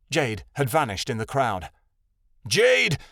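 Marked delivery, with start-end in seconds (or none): 2.5-3.0 high, loud cry of her name; shouting. A narrator's reading. Scene: Shadowin calling after his vanished sister, shouting. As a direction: call out after her desperately. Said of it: "Shadowin shouted".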